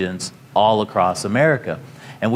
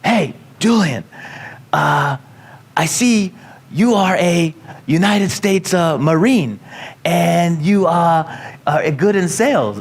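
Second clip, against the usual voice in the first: put-on mentor's Hawaiian accent, pitched higher, some words drawn out slowly; a light Hawaiian accent, higher pitched; 6 semitones higher, slower than usual. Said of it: "He was a Hawaiian guy. And he says".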